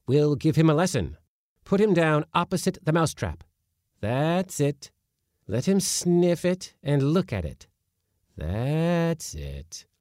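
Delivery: high, loud teacherly orders; eager schoolmaster. A narrator's reading. Uncle instructing Praskovia and kitten, high and loud. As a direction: drill the kitten like a pupil.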